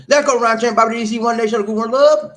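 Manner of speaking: loud, high roll-call holler; hyped shout-out. Real, not acted. Host calling out viewers by name, hyped shout-out. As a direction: belt out the names like a hype man.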